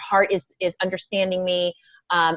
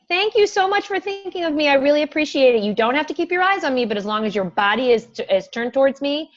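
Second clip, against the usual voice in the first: put-on higher-pitched, encouraging thanks to a child; appreciative teacher; 7 semitones higher than usual. Shown as a demonstration. Teacher thanking Beth for thinking of her, appreciative and high. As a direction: praise a pupil with lifted, lilting pitch. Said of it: "what are you gonna say to me".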